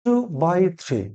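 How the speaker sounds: "two by three" drawn out very slowly; slow teaching demo. Shown as a demonstration. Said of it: "নিউমেরালসে কি বলছি?". ("two by three" খুব ধীরে উচ্চারণ করে দেখানো)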